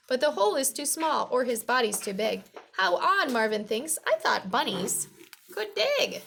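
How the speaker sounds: put-on lively, projected read-aloud for children; storybook reading. A narrator's reading. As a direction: read it out clearly to young listeners.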